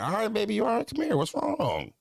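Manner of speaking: put-on high-pitched soothing dad to a crying child; lifted, babying comfort voice; acted tender concern. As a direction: coo to an upset little one. Voices tender self to crying kids.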